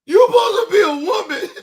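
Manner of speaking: high, loud voice cracking up with laughter; helpless, squealing through real laughter; crying with laughter. Real, not acted. Reacting to angry people online, crying with laughter. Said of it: "Y'all done got me crying".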